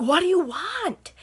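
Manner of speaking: high, loud, slow demand; What do you want, fed up and loud; irritated snap. Retold or imitated. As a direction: snap it at a pest caller. Snapping at the repeat caller.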